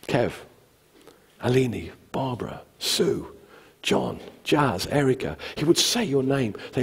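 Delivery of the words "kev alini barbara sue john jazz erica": soft, gentle calling of names; quiet, intimate roll call; tender. Retold or imitated. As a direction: call each one softly, with pauses. Jesus calling each person by name.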